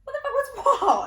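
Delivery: high, loud comeback; indignant bafflement. Retold or imitated. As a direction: throw it back loudly, half laughing. Indignant reply to friends.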